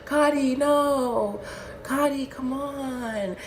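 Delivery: imitating a tipsy, dragging protest; drunk friend. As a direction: plead feebly like a drunk pal. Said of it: "drunk as fuck".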